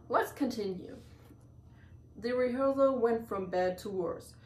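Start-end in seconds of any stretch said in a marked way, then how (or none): none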